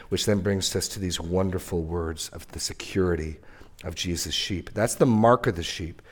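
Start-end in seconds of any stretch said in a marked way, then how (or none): 0.1-4.7 hushed whisper. Real, not acted. Preacher hushing the congregation before good news, whispered. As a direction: drop to a soft whisper.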